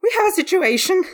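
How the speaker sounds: high-pitched anxious Frog character voice; panicky deep breathing. Acted. Frog clutches Dawn's sleeve to warn his friends, panicky. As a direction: alert your friends in a high, worried voice. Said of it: "he was breathing de-, deeply".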